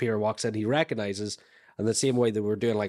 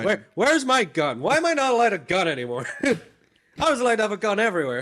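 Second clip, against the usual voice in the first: mock whiny cop; 9 semitones higher, 5 dB louder than usual. Mock cop voice demanding gun.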